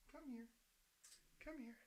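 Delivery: high pet talk. Real, not acted. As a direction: call the pet sweetly up high. Coaxing pet to come.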